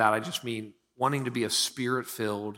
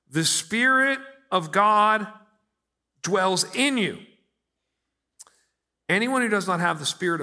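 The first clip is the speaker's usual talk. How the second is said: raised, emphatic Bible reading; scripture reading; 4 semitones higher, 6 dB louder than usual. Acted. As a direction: proclaim the verses to the congregation. Reading scripture aloud in sermon.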